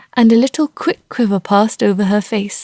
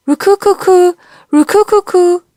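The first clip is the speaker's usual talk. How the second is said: high, loud bird coo; pigeon cooing; 10 semitones higher, 5 dB louder than usual. A narrator's reading. A pigeon cooing outside, high and loud. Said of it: "sounded from the quiet".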